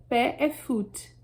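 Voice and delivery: ordinary talk, nothing marked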